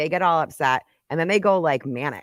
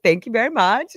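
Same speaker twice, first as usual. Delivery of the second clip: put-on Russian accent; 7 semitones higher, 5 dB louder than usual. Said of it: "the Russian accent".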